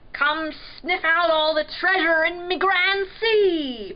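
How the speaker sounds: high, loud pirate call. A narrator's reading. Pirate luring the dogfish to hunt treasure, calling. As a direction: summon the pup like a hearty pirate. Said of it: "Pirate called".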